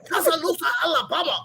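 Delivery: laughing while mimicking the waiter; laughing imitation. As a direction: mimic the accent, breaking into laughter. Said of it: "I'll never forget it".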